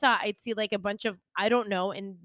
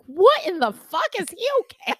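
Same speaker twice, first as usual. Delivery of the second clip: much higher, loud mock shock; friend's shocked voice; 9 semitones higher, 6 dB louder than usual. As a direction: play the stunned friend. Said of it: "She's like".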